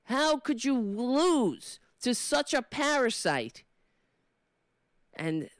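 indignant rant, pushed up in pitch; heated, emphatic political scolding; impassioned tirade. Acted. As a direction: lecture a politician with rising exasperation. Rehearsed tirade at Democratic representatives.

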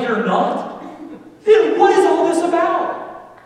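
high, loud incredulous retort; acted bafflement. Husband to wife after her reply, loud bafflement. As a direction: burst out high, slowing in disbelief.

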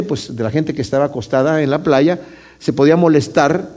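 ordinary talk

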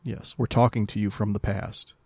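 ordinary delivery

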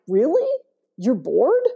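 high, rising disbelief; mildly mock-incredulous questioning. Guest retorts to people who say they're bored, incredulous. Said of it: "I'm like".

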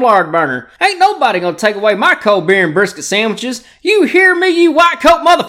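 put-on loud Texan good ol' boy; Texas accent. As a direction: holler like an angry Texan. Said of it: "Or Bob from Texas".

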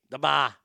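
"bar" said as "bah". Shown as a demonstration. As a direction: say "bar" with no R, open "ah". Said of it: "It's B A".

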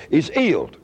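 "He's healed" said as 'e's 'ealed; dropped H's. Retold or imitated. Wigglesworth answering the doctor, "He's healed" with silent H's. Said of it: "he didn't pronounce H's".

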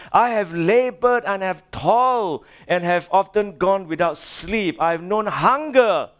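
high, driving preacher's recital; impassioned scripture reading. Acted. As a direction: hammer out the list of hardships with fervor.

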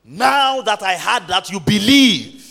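booming, stretched-out sermon cry; full-force preaching. Real, not acted. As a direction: proclaim it to the whole congregation, drawing out key words. Preacher proclaims Paul's words.

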